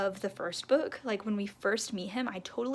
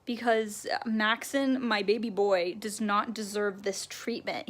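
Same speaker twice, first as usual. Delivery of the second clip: normal talking voice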